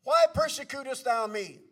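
loud, booming call from heaven. Retold or imitated. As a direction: thunder it out as God calling a man by name. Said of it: "And that voice said".

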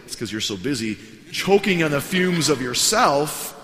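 exasperated retort. Retold or imitated. What pastor wanted to tell the boaster, exasperated. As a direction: let the pent-up annoyance burst out slowly.

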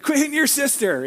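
high-pitched acted scolding; mock parental yell. Parent to child hitting a sister, mock yelling. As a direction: call out a telling-off to the kids.

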